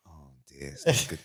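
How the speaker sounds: very soft, low, understated dismay; quietly concerned aside; hushed concern. Retold or imitated. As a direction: murmur it as a private worry. Retold worried word outside.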